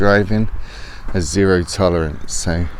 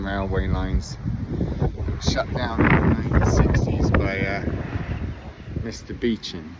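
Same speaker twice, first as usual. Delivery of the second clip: hushed; 11 dB quieter than usual. Real, not acted. Softly explains by the cache.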